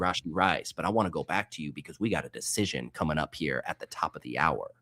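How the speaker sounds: low, soft late-night radio host voice; smooth jazz DJ. Acted. Host to cohost on the draft, low smooth jazz DJ voice. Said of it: "must talk like they're hosting a smooth jazz radio station".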